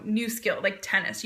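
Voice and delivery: normal talking voice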